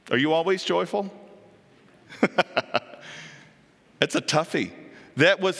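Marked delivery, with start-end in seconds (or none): none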